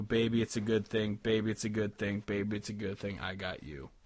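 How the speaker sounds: ordinary talk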